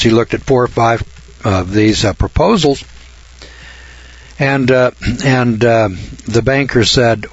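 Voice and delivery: ordinary delivery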